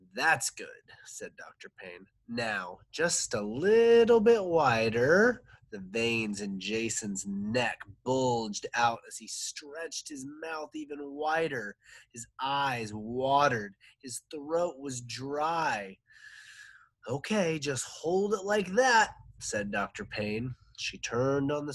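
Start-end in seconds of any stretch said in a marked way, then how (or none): 0.1-5.4 dentist voice. Acted. Dentist coaxing patient wider. 17.1-19.2 dentist voice. Acted. Dentist holding patient's mouth open.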